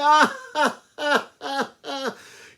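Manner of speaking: huge, high, loud "ha-ha-ha"; long staged belly laugh at the tiny mouse; mocking lion's laugh. Lion mocks the mouse's offer.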